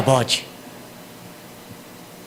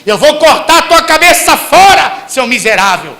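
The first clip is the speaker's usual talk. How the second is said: mock rage, much higher and louder on the middle; furious parent threat; 7 semitones higher, 6 dB louder than usual. Parent threatening a misbehaving child, hammed-up furious shout. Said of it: "que você não fala".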